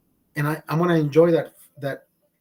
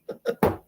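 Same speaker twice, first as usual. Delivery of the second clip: short laugh. Real, not acted. Laughing at the joke.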